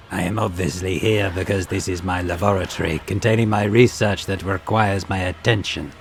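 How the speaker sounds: a lightly voiced disdainful scholar; haughty xenos voice. Tavantius answers condescendingly.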